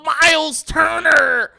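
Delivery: high, slow crying shout; whining fan. Acted. Bulls fan answering who to trade for, mock wailing. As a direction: wail the demand in a mock-fan voice. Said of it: "Whining ass Bulls fans".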